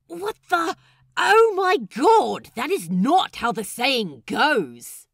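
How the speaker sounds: high, loud, stretched "Oh my God"; can't believe what he's hearing; exasperated disbelief. Acted. Lucius scolding the crew's mangled saying, exasperated.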